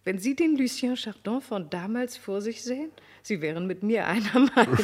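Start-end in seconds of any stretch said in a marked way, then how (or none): 3.2-4.8 laughing aside. Acted. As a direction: laugh breaks in, louder. Marquise lacht mitten im Satz. (mit einem Lachen mitten im Satz)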